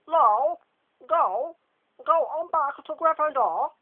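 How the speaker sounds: loud, high stammering command; Lockhart's order. Acted. Lockhart sending James back, loud high command. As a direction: send him off, booming.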